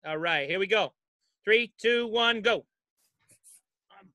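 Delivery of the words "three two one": ordinary delivery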